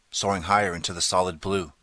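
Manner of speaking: ordinary delivery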